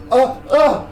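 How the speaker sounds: high grunts of being hit; jolted grunts, as if taking bullets; acted groans of impact. And she just kinda.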